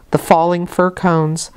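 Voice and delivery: plain reading voice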